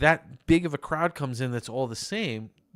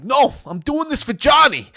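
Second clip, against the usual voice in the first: mock heroic; 8 semitones higher, 9 dB louder than usual. Son held back by wife.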